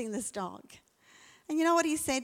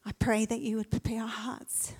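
prayer. Acted. Leads a prayer.

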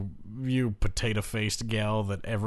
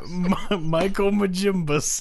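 silly voice; 7 semitones higher, 4 dB louder than usual. Pretend. Mangled name in silly voice.

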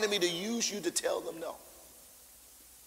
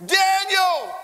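"Daniel!" wailed out much higher and louder; anguished cry; 14 semitones higher, 13 dB louder than usual. Shown as a demonstration. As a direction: let "Daniel!" burst from the heart. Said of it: "this is from his very bowels".

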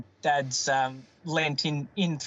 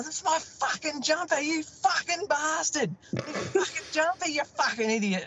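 very high, strained mock rant; imitating ranting dad; 13 semitones higher than usual. Dad cursing son over his ruined jumper, mock furious rant. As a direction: do Dad losing it, pitch way up without more volume. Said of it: "Dad's going".

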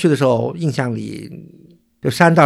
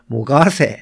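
Suzhou dialect; 3 dB louder than usual. Shown as a demonstration. Explaining the hill's local name, in Suzhou dialect. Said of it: "用苏州话说叫".

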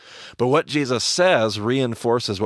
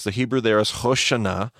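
ordinary talk

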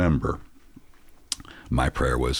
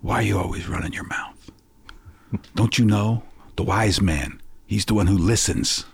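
stern lecture; 7 semitones higher than usual. Retold or imitated. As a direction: press the lesson home, growing firmer. Grandfather lectures boy on wisdom.

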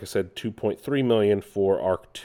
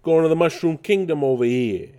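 higher, New York wise-guy Mario; New York accent; 6 semitones higher, 4 dB louder than usual. Retold or imitated. Mocking the movie Mario to viewers, New York accent. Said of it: "a little bit of a New York in it".

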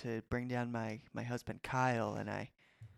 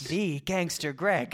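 slow, higher, star-struck; awed; 5 semitones higher, 5 dB louder, much slower than usual. Acted. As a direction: gasp it out in awe. Awed recognition of the gangster.

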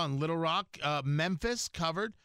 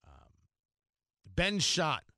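usual speaking voice

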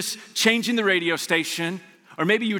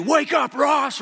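barked, louder and higher; mock coach shouting at a player; angry coach yell; 6 semitones higher, 3 dB louder, slower than usual. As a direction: yell it like a furious football coach. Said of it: "your football coach that was always angry at you".

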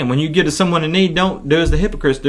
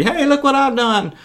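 high-pitched show-off voice; mock self-important boasting; boastful hypocrite; 6 semitones higher than usual. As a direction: brag for everyone to hear, pitched way up. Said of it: "to call attention to their acts of charity".

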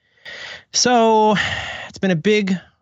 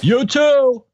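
high, loud awkward you too; awkward reply; 10 semitones higher, 4 dB louder than usual. Retold or imitated. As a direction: blurt it back too fast. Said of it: "he does the thing".

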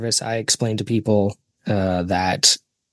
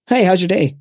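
high, louder cashier greeting; chatty cashier; 7 semitones higher, 5 dB louder than usual. Retold or imitated. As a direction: greet like an over-friendly cashier.

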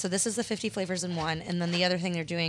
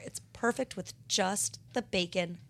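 ordinary delivery

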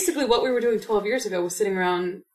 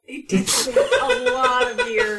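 laughing through the words; 13 semitones higher, slower than usual. Real, not acted. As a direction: chuckle it out, slowly. Joking about A Winter's Tale.

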